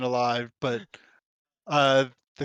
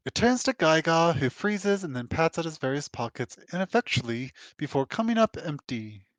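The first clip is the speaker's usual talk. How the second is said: ordinary talk, nothing marked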